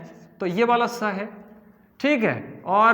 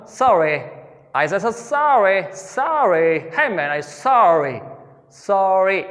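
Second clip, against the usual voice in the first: American accent; much slower than usual. Shown as a demonstration. Teacher showing students a proper American r. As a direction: say sorry the American way, r curled. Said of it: "अब इसको proper बोलूंगा मैं आर को".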